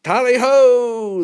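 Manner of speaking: long, high, loud battle cry; drawn-out, soaring huntsman's cry; hollered. A narrator's reading. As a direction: holler it as the plane dives. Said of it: "the pilot hollered".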